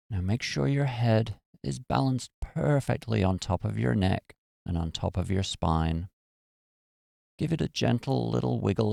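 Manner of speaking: calm, even meditation voice with long pauses; guided meditation. Acted. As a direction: guide them gently into relaxation, phrase by phrase.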